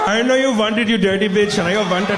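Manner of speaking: high-pitched, hammed-up East Indian accent. Indian lover demanding his name be said, East Indian accent. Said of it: "is that East Indian accent".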